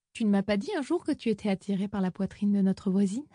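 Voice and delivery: ordinary delivery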